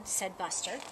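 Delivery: usual speaking voice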